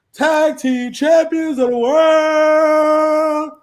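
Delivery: booming ring announcer intro; ringmaster. Acted. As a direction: hype it up like a wrestling announcer. Announcer hype intro, shouting.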